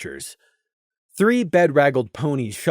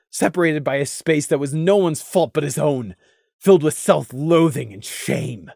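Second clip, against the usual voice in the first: ordinary delivery, nothing marked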